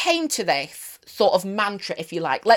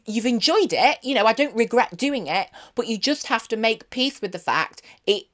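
normal talking voice

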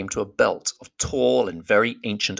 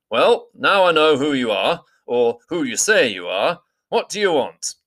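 louder, higher skeptical challenge; Beorn demanding; 6 semitones higher, 4 dB louder than usual. A narrator's reading. Beorn doubting Gandalf and demanding his purpose. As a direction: press the stranger for an answer.

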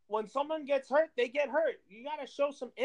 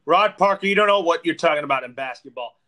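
loud angry rant; unleashing; 5 semitones lower, 11 dB louder than usual. Real, not acted. Cohost rips into Rob Parker on request, loud angry rant. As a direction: blast him with full force. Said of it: "unleash on Rob Parker".

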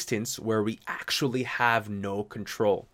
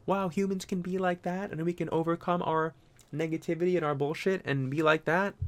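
awed viewer; 6 semitones higher, 4 dB quieter than usual. Retold or imitated. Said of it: "inspirational and like".

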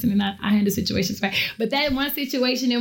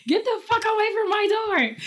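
put-on high, sharp teen shout at the door; daughter yelling; 11 semitones higher than usual. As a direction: snap at the scratching dog like an upset teenager. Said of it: "So Natalie was like".

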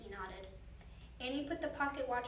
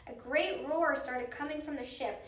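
plain reading voice

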